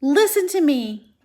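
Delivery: high and loud plea; urgent cry. Acted. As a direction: cry out for his attention. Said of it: "Wilbur cried".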